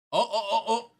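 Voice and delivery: loud, high, rapid-fire ohs; excited discovery. Real, not acted. Streamer finally finding the geckos online, real excitement. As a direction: burst out as you spot it. Suddenly finds the searched-for page.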